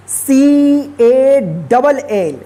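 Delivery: letters spelled out slowly one by one; slow spelling. Shown as a demonstration. Teacher spells word letter by letter.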